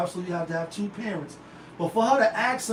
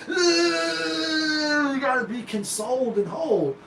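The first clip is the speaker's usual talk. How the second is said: mock sobbing; 6 semitones higher, slower than usual. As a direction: parody a crying draftee, hammed-up. Mocking draftees' crying.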